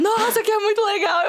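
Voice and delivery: high, laughing, thrilled quote; excited gush. Retold or imitated. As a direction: gush about it with delight. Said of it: "Cracóvia, você tá assim". (empolgado, rindo de alegria)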